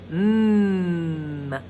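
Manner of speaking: long low hummed mm; humming mm. Shown as a demonstration. Teacher showing the kids the M sound as a hum. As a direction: hold a closed-lip mm sound. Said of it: "see if you can make that sound".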